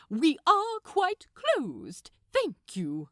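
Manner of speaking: a polite, prim brush-off called through the door; prim refusal. A narrator's reading. Aziraphale turning away a caller through the door, prim and loud.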